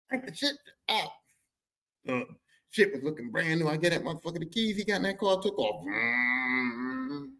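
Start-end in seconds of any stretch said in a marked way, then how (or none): none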